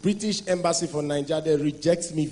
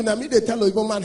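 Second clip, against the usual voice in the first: ordinary talk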